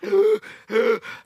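heavy, labored gasps; imitated panting. Comedian beside the effortless runner, exaggerated gasping. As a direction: act out running out of air. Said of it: "I'm like".

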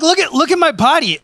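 high, loud mock bragging; showing off. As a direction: strut it like you're flexing a new body. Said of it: "I'd be like".

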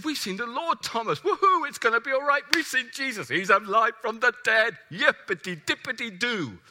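high, loud, gleeful cheering; excited disciples. Acted. Disciples telling Thomas they saw Jesus, gleefully excited.

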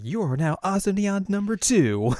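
high, cutesy christening of a pet; imitated girl grandly naming her cat; mock proclamation. Azusa names the cat.

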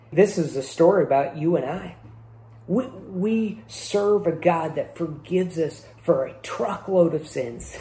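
ordinary delivery

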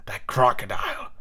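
low, slow, husky captain; drawn-out, uneasy villain; haunted Hook. Acted. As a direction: confide the dread slowly and low. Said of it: "there was a quiver in his voice".

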